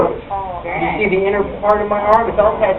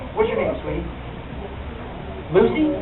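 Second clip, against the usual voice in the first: sweet question; 10 dB quieter than usual. Real, not acted. Sweetly asking a grandmother.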